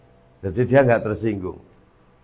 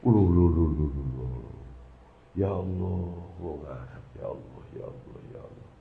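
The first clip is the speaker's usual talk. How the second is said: stunned murmur; 7 semitones lower, 12 dB quieter than usual. Retold or imitated.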